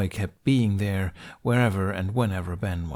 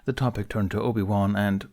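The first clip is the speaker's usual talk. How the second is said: ordinary delivery, nothing marked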